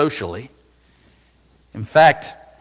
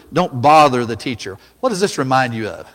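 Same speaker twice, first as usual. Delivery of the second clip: normal talking voice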